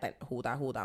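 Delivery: ordinary talk, nothing marked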